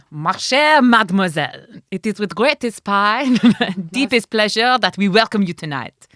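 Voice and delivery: put-on French candlestick accent, grand and loud; Lumiere French accent. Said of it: "The only French I could do would be Lumiere".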